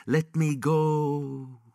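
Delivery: high, very slow echoing plea; drawn-out plea. Acted. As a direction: stretch the plea out, begging. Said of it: "His plea reverberated".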